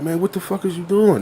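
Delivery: lightly voiced little kid cussing; imitating a kid. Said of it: "This is how he's talking".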